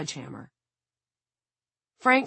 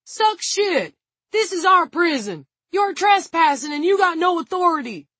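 very high, loud yelling; defiant yell; 12 semitones higher, 6 dB louder than usual. A narrator's reading. Tig defending his prison from trespassers, defiant high yell. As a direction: yell back in furious defiance. Guard yells defiance in gunfight.

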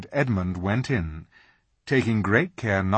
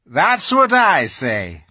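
slow, loud beaver voice; quick agreement; Mister Beaver agreeing; Mister Beaver chiming in; 5 semitones higher, 9 dB louder, slower than usual. A narrator's reading. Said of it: "chimed in Mister Beaver".

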